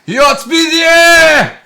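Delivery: teriakan panjang beraksen Rusia, sangat tinggi dan keras; Rocky Four Russian quote. Retold or imitated. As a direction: bellow it slow like Ivan Drago. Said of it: "that's from Rocky Four".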